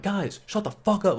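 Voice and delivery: high-pitched mock yell; strained high shout, held back in volume; retold yell. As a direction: imitate yelling over a noisy crowd. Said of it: "I yell at the top of my lungs".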